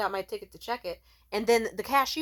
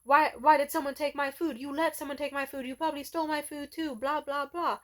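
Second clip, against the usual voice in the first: mock angry yelling; 7 semitones higher, 3 dB louder than usual. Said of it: "yelling at her all angry".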